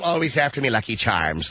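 Lucky Charms leprechaun brogue; cartoon Irish voice; Irish accent. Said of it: "it's not this whole".

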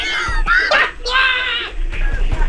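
high wheezing cackle; drawn-out emphysema laugh. Real, not acted. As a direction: laugh long and high like failing lungs. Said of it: "that was the most emphysema laugh".

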